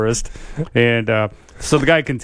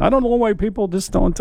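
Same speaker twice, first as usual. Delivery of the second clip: higher-pitched, lightly voiced Italian accent; imitated Italian accent; 8 semitones higher than usual. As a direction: retell it with a touch of Italian accent. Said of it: "he's talking in an Italian accent".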